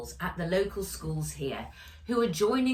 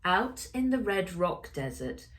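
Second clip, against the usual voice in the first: ordinary delivery, nothing marked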